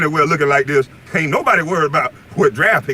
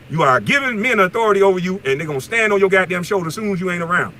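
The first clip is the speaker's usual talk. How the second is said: normal talking voice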